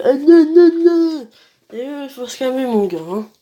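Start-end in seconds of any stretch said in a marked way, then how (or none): 0.0-1.3 higher, mocking yelled protest; mock bawling. Player mocks the guy who bawls like a skunk, mock bawling. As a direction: imitate someone bawling. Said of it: "le mec il gueule".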